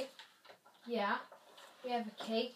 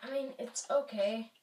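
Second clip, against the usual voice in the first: ordinary talk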